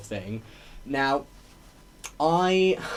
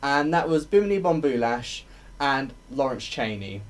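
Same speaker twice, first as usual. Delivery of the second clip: normal talking voice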